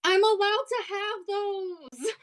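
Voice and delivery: high, drawn-out whiny protest; defensive whine. Acted. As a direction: plead indignantly in a high voice.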